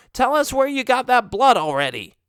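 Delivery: squeaky impatient bat; high, pushy little creature. Acted. Bats voiced, impatient demand.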